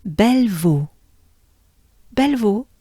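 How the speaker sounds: Bellevaux as bel-vo, stress at end; French Bellevaux. Shown as a demonstration. Teacher modelling the town name Bellevaux. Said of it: "together, ensemble".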